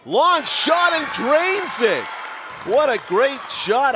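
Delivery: high, loud, drawn-out shot call; excited play-by-play call. Real, not acted. Announcer calling a long shot, thrilled shout. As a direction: belt out the long basket.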